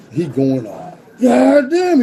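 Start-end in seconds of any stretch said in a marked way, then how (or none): 1.2-2.0 high, loud furious yell; Big Mike raging. Retold or imitated. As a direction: bellow like a giant losing it. Big Mike going off in bullpen.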